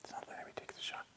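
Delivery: real hushed whisper; very soft, quick whisper. Real, not acted. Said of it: "Why are we whispering?".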